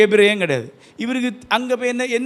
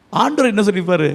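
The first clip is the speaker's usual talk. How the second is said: ordinary talk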